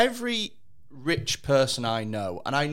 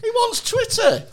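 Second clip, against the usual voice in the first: high mocking voice; 4 dB louder than usual. Mocking tone about Musk.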